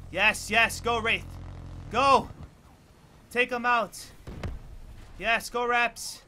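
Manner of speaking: root the teammate on; excited cheering. Real, not acted. Gamer cheering on teammate Wraith, excited.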